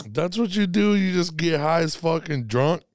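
loud laughing bro banter; frat bro. Real, not acted. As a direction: hype it up, laughing loudly. Laughing, teasing after the hit.

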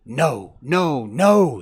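loud, climbing refusals; worked-up, punched repetition; excited. A narrator's reading. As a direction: cut him off excitedly. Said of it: "the dog said excitedly".

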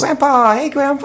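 put-on high-pitched six-year-old's greeting; excited little kid. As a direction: call out eagerly to grandpa in a child's voice. Plays six-year-old greeting his grandpa.

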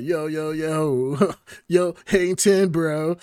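hammed-up caricature, drawn out. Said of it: "making stereotypes and caricatures".

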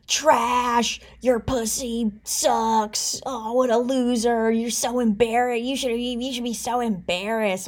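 lightly voiced sneering troll; mocking sneer. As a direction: sneer it out like an online hater. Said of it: "They were like".

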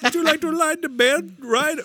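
high, hammed-up Middle Eastern accent, inviting; mock Middle Eastern accent. Knott's Berry Farm ride host inviting guests, mock Middle Eastern accent. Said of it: "use his real accent".

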